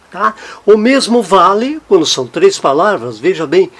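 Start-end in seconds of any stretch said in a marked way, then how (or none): none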